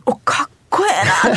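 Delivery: retold awe. Host retelling her reaction to a friend's international school, awed. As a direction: gush it as a remembered impressed reaction.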